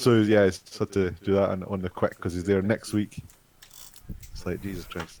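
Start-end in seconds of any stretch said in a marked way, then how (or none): 4.3-5.2 a tired mutter of disbelief; muttered under breath. Real, not acted. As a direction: drop it to a quiet mutter. Repeats exasperated remark, muttered.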